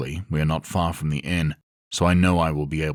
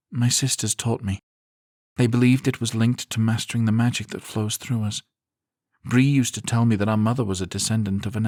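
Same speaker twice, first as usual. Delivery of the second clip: higher woman's voice, wistful; sad woman's voice; 6 semitones higher than usual. A narrator's reading. Zarina explains sadly.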